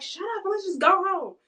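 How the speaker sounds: high, loud mock scolding; exasperated. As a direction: imitate a fed-up woman cutting him off. Woman scolding crying man, retold.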